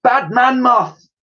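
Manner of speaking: high, slow scolding yell; stretched-out, high-pitched telling-off. A narrator's reading. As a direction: yell it at a naughty animal. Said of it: "yelled Dotty".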